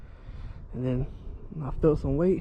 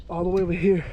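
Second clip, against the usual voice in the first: panting after running; breathless from the sprint; out of breath; 3 semitones higher, faster than usual. Real, not acted. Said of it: "I'm out of breath".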